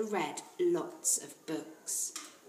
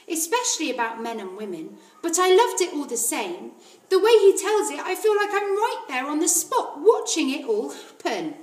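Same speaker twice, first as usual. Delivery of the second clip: put-on higher, louder, excited little girl; a small girl gushing about a book she loved; eager child's voice rising with delight; Matilda; 8 semitones higher, 8 dB louder than usual. Narrator voices Matilda, enthusiastic.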